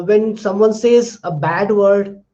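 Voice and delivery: ordinary talk, nothing marked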